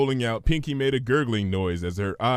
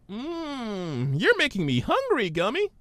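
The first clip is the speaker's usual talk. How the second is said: much higher, bubbly pony; Pinky's voice; 12 semitones higher than usual. Acted. Pinky puzzled by Twilight's big word, high voice.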